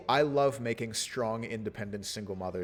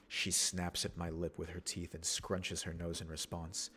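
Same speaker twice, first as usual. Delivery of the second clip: softer, measured narration of the scene; story narration; 5 semitones lower, 5 dB quieter than usual. Acted. Streamer reading the game event aloud, soft narration.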